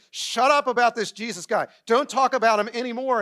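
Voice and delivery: mock-indignant command; stern order. Leaders ordering disciples to be silent.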